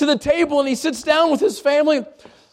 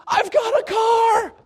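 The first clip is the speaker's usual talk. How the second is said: much higher, slow ecstatic shout; so excited; 12 semitones higher, slower than usual. Retold or imitated. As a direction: shout out thrilled at the car. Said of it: "he just gets so excited".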